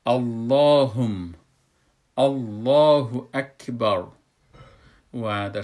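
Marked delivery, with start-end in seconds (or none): 0.1-4.0 slow, loud recitation with a thick L in الله; deliberate tajweed demo, heavy L; full, heavy Allah. Shown as a demonstration. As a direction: hold the dark, rounded "Alloh" long.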